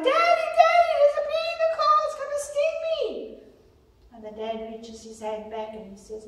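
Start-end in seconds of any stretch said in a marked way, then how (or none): 0.0-3.4 put-on high, frantic child's cry to her dad; child shrieking about a bee in the car; panicked little girl. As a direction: squeal like a terrified little girl stung by fear. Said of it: "the little girl carries on".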